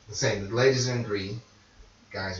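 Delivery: ordinary talk, nothing marked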